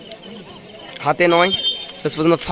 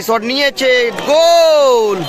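shot call rising into a goal shout; 6 semitones higher, much slower than usual. Real, not acted. Commentator calls a goal.

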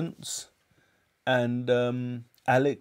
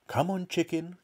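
high, light sing-out to an animal; coaxing; 5 semitones higher, 4 dB quieter than usual. A narrator's reading. George calling the hen to the spoon, coaxing. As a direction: lure it over in a raised little voice.

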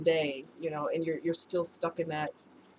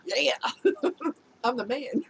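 imitating a self-satisfied guy; mock proud; 4 semitones higher than usual. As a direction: play his cocky pride, amused. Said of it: "I'm sure he felt proud".

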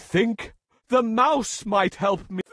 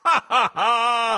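loud, higher acted "ha, ha, ha"; lion's laugh; 5 semitones higher, 5 dB louder than usual. A narrator's reading. Lion scoffing at the pleading mouse, loud laugh. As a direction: laugh out big and theatrically. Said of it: "laughed the lion".

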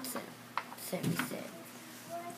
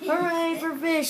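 cheering seals; 8 semitones higher, 5 dB louder than usual. Acted. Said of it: "said the seals".

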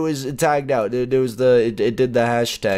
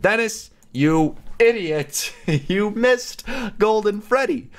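higher, slow mock scold of a fan; 10 semitones higher, slower than usual. Imitating fans scolding him for missing Golden Freddy. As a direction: sneer like an annoyed viewer. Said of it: "You guys said".

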